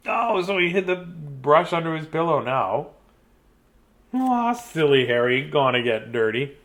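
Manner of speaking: ordinary delivery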